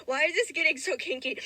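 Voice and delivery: ordinary talk, nothing marked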